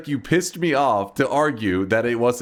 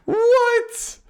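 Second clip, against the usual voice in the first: very high incredulous squeak; can't believe the ruling; shocked what; 4 dB louder than usual. Real, not acted. Streamer hearing the ref ruling, very high shocked yelp.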